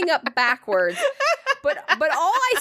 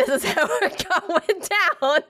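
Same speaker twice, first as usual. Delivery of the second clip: slow, higher, dissolving into laughter; laughing; 6 semitones higher, slower than usual. Retold or imitated. Telling her friend the punchline, slow and laughing. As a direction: laugh it out slowly.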